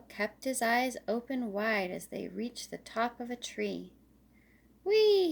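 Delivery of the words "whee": high, loud cry of delight; joyful shout. Acted. Little Squirrel rides Daddy's shoulders through woods, whooping loud. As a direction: whoop with joy on the ride. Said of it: "he shouted".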